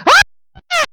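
mock high shriek from the daughter; daughter's scream. Daughter buried in snow, mock high scream. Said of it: "she's screaming".

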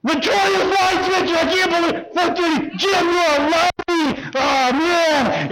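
high, put-on disbelief, acting innocent; mock outrage. College prankster to hallmates, faking high-pitched shock. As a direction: pretend to be shocked the dorm got hit again.

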